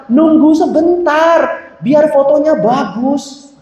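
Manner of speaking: high, drawn-out imitated protest; acted-out plea, slow and high. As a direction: stretch the words out high, asking for patience. Confused young photographer answering back.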